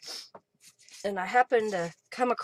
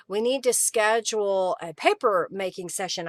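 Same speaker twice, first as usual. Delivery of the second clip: normal talking voice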